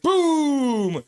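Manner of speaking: high, loud, drawn-out "boom"; playful explosive cheer; acted boom shout. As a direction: holler it in celebration, falling at the end. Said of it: "Big Mac's like".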